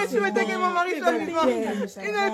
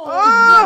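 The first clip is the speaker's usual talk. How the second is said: louder crying wail; loud sobbing oh; 8 dB louder than usual. Acted. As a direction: break into a loud sob. Mother wails.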